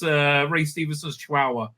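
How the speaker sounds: ordinary talk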